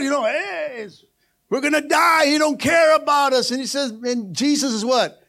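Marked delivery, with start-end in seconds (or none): 0.2-3.5 panicked disciples. Retold or imitated. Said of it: "disciples started to trip out, freak out".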